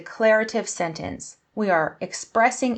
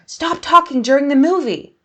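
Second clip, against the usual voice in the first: louder, higher, upset command; angry exclamation; 7 semitones higher, 7 dB louder than usual. Shown as a demonstration. Teacher showing an exclamation mark to someone talking during a film, angry exclamation. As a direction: snap it out as an angry order. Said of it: "Maybe I'm really angry, I'm getting upset".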